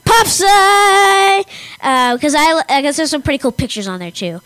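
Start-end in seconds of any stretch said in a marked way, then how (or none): 0.0-1.4 loud big reveal, high; announcer shout. Acted. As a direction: belt out the name like a jingle. Shouted punchline after wait for it.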